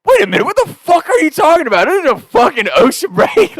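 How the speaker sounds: high, loud and fast, suddenly fed up; outraged shout. Retold or imitated. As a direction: explode at the friends for slacking. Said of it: "snaps out of it".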